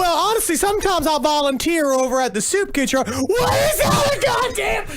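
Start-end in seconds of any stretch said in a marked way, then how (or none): 3.0-4.9 high, slow, shouting elf; a grumpy elf yelling at work; cranky elf voice. Pretend. Elf joke, put-on voice.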